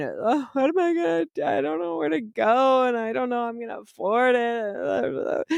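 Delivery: high, wailing self-pity, imitating her old self; mock whiny crying. Retelling to her coach, a high whiny mock lament. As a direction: whimper it like a helpless crybaby. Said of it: "And I was crying to her".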